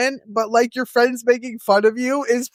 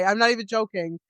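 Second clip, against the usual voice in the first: ordinary talk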